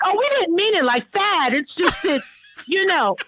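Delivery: high, placating mock plea; backpedaling boys. Boys caught by mother asking for breathing room, high placating. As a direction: imitate kids pleading their way out of trouble. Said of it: "then they try to cop deuces".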